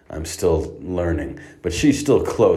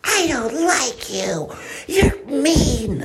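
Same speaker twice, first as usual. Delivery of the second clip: put-on much higher, slow, whiny toddler sobbing at the guard; crying little girl; much slower than usual. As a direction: wail like a cross four-year-old. Four-year-old scolding the guard.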